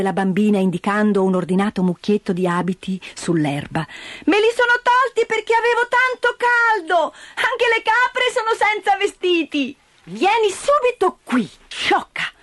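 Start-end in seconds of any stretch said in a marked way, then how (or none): 4.3-9.8 put-on high, bright child voice, carefree; cheerful little girl. Heidi tells her aunt why she undressed, high child voice. 10.0-12.3 put-on high, sharp scolding of the child; cutting short. Aunt Dete scolds Heidi, sharp high scolding. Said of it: "tagliò corto Dete".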